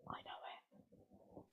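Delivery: very soft, slow whisper. Real, not acted. Player quietly lets on she knows the answer, whisper. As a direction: whisper it as a secret aside.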